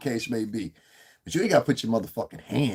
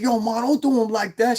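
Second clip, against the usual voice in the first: mock high plea; 10 semitones higher, 3 dB louder than usual. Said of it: "I said".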